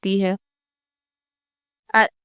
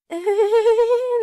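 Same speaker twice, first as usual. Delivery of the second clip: giggle; 9 semitones higher, 5 dB quieter than usual. Acted. Daughter-in-law laughs at wrong time.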